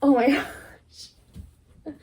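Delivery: quick, laughing whisper; a breathless, giggly oh my gosh. Real, not acted. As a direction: giggle it out under your breath. Overwhelmed by the lyric.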